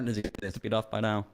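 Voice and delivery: usual speaking voice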